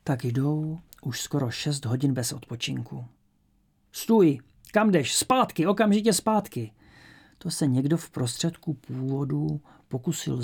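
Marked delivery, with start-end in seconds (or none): 3.9-6.7 high, loud shouted commands; barked guard's order. A narrator's reading. Guard shouting at a prisoner who breaks from the column, commanding shout. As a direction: halt a runaway with sharp, high shouts.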